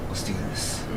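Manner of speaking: low, barely voiced mutter; quiet self-psyching. Intimidated fan steeling himself before introductions, low hushed mutter. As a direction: mutter it under your breath, steeling yourself.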